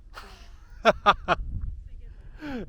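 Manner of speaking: airy laugh high up; high breathy laugh. Real, not acted. Laughing at six-hour hike.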